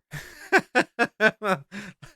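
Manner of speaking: high, loud burst of laughter; helpless high-pitched laughing fit; real hearty laugh. Real, not acted. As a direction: crack up at your own joke. Cracks up at own impression.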